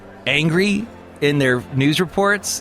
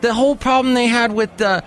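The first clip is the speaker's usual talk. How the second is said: normal talking voice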